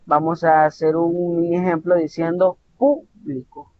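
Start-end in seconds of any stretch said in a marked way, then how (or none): 2.8-3.7 PÚ-blico, first syllable hit hard, then falling; stress on first syllable. Shown as a demonstration. Teacher showing emphasis on a syllable, PÚ stressed in "público". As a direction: punch the PÚ of "público". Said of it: "como vamos a hacer énfasis en una sílaba".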